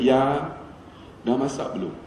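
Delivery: soft, low question to his wife; cakap lembut. Retold or imitated. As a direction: ask your wife very gently, voice dropped. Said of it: "Saya cakap lembut". (tanya isteri dengan suara rendah dan lembut)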